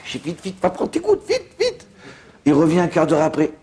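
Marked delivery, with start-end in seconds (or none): 0.3-1.9 high, laughing urging; urgent hurry. Retold or imitated. Rabbi rushing the man to his drops, high laughing hurry. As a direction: rush him along with a laugh.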